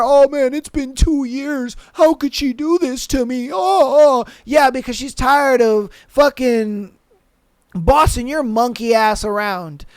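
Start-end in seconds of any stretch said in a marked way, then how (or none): 0.0-4.2 whiny mock lament, rising to a yell; hammed-up heartbroken guy; mocking the whiny boyfriend. Podcaster mocks a cheated boyfriend, whiny mock wail. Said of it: "And then you're sitting there".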